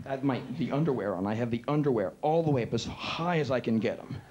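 ordinary delivery

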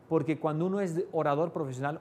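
normal talking voice

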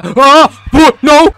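high, loud burst of shock; alarmed yell. Real, not acted. Gamer hit by a sudden setback, alarmed. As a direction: cry out in startled disbelief. Sudden bad turn in game.

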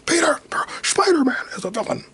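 high, loud newsman rant; Jonah Jameson. Retold or imitated. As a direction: bark it like an angry newspaper editor. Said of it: "Jonah Jameson again".

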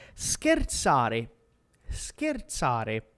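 "scherzare" slow and low, every syllable clear; pronunciation model. Shown as a demonstration. Teacher has learners repeat to practise, slow clear "scherzare". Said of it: "Ripeti con me per praticare la pronuncia".

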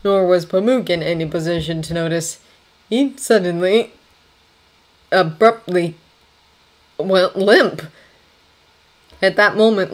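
ordinary delivery

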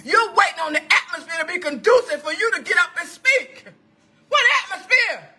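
high, challenging pulpit cry; incredulous preaching. Acted. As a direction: fling the question at the congregation. Preaching at full force.

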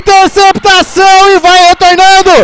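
loud, high interception call; excited call. Real, not acted. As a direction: call the pick with a shout. Interception called on air.

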